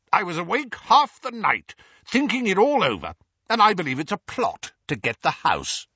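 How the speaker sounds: suspicious uncle. A narrator's reading. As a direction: accuse him with conviction. Vernon's paranoid accusation.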